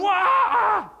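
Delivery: loud, high re-enacted "wah"; wild yell. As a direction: let out an ecstatic mock holler. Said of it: "like this".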